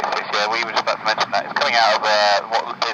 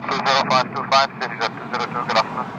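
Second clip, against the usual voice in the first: pilot radio; faster than usual. Real, not acted. Pilot signing off over radio.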